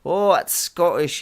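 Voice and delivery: put-on slow, loud, high overblown Scottish accent. Dad voicing a Scottish rubber duck for his kids in an overblown Scottish accent. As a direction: ham up a Scottish brogue, stretching every word. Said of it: "And I would do".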